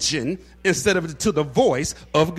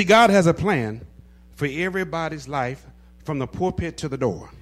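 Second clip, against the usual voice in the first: ordinary talk, nothing marked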